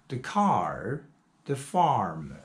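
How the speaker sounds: "car" and "farm" with a clear American R colouring the long ah vowel. Shown as a demonstration. Teacher shows students the American R in car, farm. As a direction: curl the tongue for the R in "car" and "farm". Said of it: "usually the American pronounce ... with the R".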